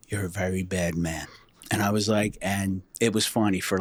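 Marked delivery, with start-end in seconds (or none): 0.1-1.3 low, soft retold reproach; hushed imitation of a cowed man; meek accusation. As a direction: say it quietly, low in the voice. Same subdued man's line repeated.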